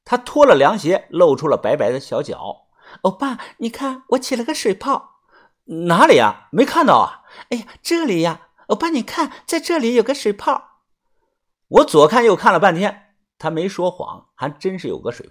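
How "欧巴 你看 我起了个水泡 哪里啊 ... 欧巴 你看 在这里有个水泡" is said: high cutesy coaxing; high sweet wheedling girl voice; coquettish girl. A narrator's reading. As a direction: play for sympathy. Girl shows blister coyly.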